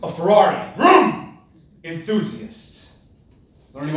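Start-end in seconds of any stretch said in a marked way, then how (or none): 0.0-1.4 vroom sound effect. Pretend. Engine noise in speech.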